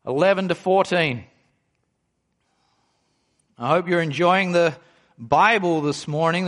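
ordinary delivery

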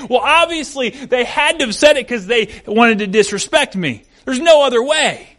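hammed-up indignant self-talk; aggrieved inner voice. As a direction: act out a mind jumping to the worst conclusion. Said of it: "story that keeps going in your brain".

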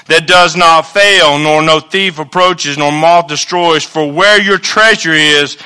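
loud, slow pulpit reading; full-force sermon reading; preaching scripture. Acted. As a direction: proclaim each line of the verse.

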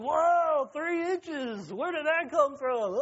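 high, loud put-on voice, gasping at his own growth; a hammed-up teen, high and slow at the end; amazed kid voice. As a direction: wake up shocked you grew overnight. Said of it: "He doesn't talk like that anyways".

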